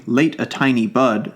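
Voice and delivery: plain reading voice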